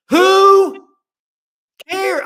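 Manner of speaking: high, loud exasperated outburst; exasperated shout. Real, not acted. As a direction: throw it out like it's obvious. Host exclaims in exasperation.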